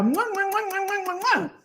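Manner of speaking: between kisses. Real, not acted. Blowing kisses to viewers.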